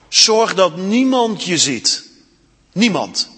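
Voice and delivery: slow, with niemand weighted and falling; stress on niemand. Acted. Aslan warns the girls, slow and insistent on niemand. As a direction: lean hard on niemand, slowly.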